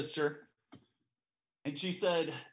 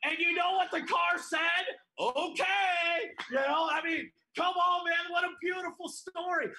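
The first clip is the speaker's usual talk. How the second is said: high, loud shouted preaching; 10 semitones higher, 3 dB louder than usual. Real, not acted. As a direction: lift the room with a cry of joy.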